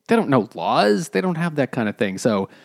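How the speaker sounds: usual speaking voice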